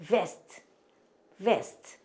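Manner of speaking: vest with a buzzing lip-to-teeth V; clear V in vest. Shown as a demonstration. Teacher has learners repeat the word for waistcoat, clear V. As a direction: start vest on V, never B. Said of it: "우리 발음 정확하게 구분해서 하셔야".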